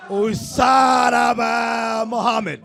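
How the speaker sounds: drawn-out, loud announcer call of a foreign name. Acted. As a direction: stretch the name out loudly like an overeager announcer.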